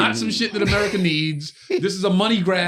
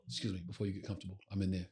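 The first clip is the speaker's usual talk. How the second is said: quiet excuse me; 10 semitones lower, 17 dB quieter, faster than usual. Retold or imitated. Same seat request retold again.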